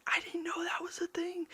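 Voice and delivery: breathy whispered admission; whispered aside. Real, not acted. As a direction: let it slip out as a whisper. Aside confessing ignorance.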